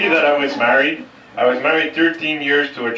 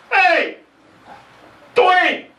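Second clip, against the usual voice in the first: yelled high and loud, last words slow; hot-tempered shout; 13 semitones higher, 3 dB louder, much slower than usual. Retold or imitated. Brother-in-law yelling at the staff for a straw, high angry shout. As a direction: bark it across the restaurant, stretching the end. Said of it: "has a famous Irish temper".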